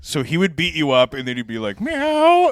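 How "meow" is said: high, loud mock meow; cat meow. Guest mimics a man meowing mid-fight, high cat voice. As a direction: play the cat to stop the fight. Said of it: "I would pretend to be a cat".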